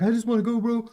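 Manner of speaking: high, loud, near-tears whine; mock tearful plea. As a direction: whimper the plea, hammed-up. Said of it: "on the verge of tears".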